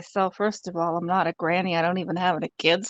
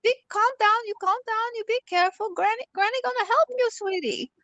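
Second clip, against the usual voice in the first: put-on much higher, doting old grandma reassuring; granny soothing the fake grandson on the phone; sweet granny voice; 13 semitones higher than usual. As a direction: coo like a gullible granny. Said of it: "he's thinking I'm some dumb grandma".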